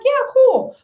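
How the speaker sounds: squeaky high "yeah, cool"; playful high brush-off; high mock casual. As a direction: toss it off way up high. Retold reply to boyfriend.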